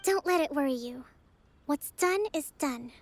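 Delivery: ordinary talk, nothing marked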